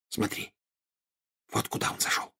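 very soft, secretive whisper. A narrator's reading. Hermione points out Malfoy's shop to Harry, whispering. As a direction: whisper it into a friend's ear. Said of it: "шепнула она на ухо Гарри".

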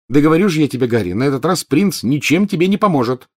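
exasperated, high insistence. A narrator's reading. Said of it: "на повышенных тонах произнесла Гермиона".

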